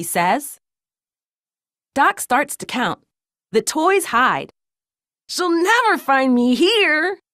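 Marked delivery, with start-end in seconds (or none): 5.3-7.2 high, mildly yelled toy voice; Louie. A narrator's reading. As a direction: call out high from a hiding place. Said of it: "Louie yells from a bin".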